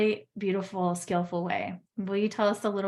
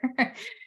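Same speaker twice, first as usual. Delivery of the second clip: a short, bright laugh; light laugh; 9 semitones higher than usual. Real, not acted. Joyful closing laugh.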